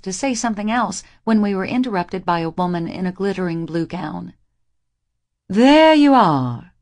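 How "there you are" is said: slow, louder, higher mother voice; slow haughty mother calling out; cool society mother. A narrator's reading. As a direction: draw it out as a haughty matron. Mother's entrance line.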